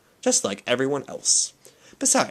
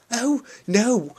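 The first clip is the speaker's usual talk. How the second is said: hurried; 12 semitones higher than usual. Acted. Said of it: "said Sister Mary hurriedly".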